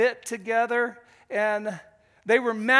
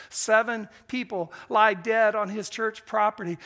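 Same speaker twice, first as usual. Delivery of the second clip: normal talking voice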